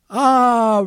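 high, loud sheepish "Ah"; hesitant ah. Retold or imitated. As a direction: hesitate before admitting it. Embarrassed reply in retold story.

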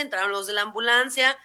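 usual speaking voice

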